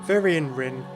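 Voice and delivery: ordinary delivery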